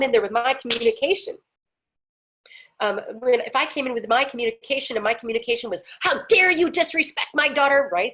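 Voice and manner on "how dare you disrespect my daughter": higher, louder mock outrage; acted aggressor. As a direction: confront them like an indignant parent. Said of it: "The aggressor".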